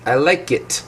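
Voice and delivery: "it" with a brief, relaxed vowel; very short I. Shown as a demonstration. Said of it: "Very short I sound".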